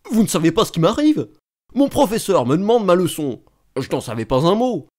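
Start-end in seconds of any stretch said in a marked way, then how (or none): none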